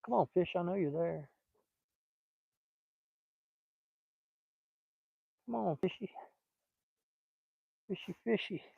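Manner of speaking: softly calling a fish like a pet; coaxing the fish. Real, not acted. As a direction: sweet-talk the fish onto the hook. Coaxing a fish onto the line.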